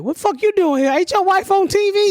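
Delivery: high voice of a mocking coworker; teasing coworker. Plant coworkers tease the husband, high mocking voice. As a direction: needle him about his famous wife.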